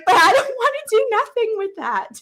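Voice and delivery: high, tearful protest; on the edge of tears, pleading; retold tearful outburst. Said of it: "I couldn't even control the tears".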